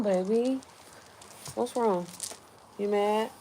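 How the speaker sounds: soft, coaxing voice to an upset pet. Real, not acted. Talking to her upset pet/child.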